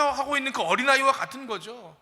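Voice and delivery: ordinary talk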